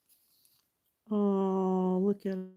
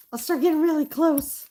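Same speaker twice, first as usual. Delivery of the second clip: much higher, louder mock squeak; squeaky repeat; 10 semitones higher, 8 dB louder than usual. Artist mocking herself to viewers, high squeaky voice. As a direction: echo yourself in a silly high squeak.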